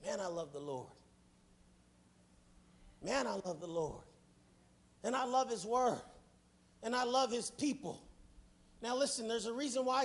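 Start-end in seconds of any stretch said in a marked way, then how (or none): 0.0-4.0 tender. Real, not acted. Quiet devotion after sermon peak.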